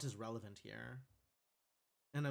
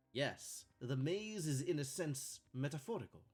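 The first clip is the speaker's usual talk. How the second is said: hammy fake Italian accent; bad Italian accent; 4 dB quieter than usual. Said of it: "when I am faking a bad Italian accent".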